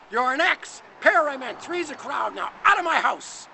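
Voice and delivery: loud, high, furious expulsion; angry banishment. Acted. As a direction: throw them out at full voice. God angrily throws them out.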